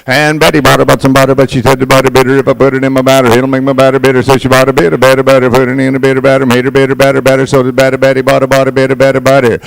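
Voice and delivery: the fast second run of the Betty Botter chant; fast tongue twister. Shown as a demonstration. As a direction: rattle it off fast and loud. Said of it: "two last Betty Botters".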